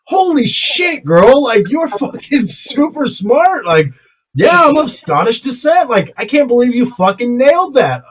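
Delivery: high-pitched, bowled-over gushing; amazed excitement. Retold or imitated. Customer retells praising the bartender who nailed his name, thrilled.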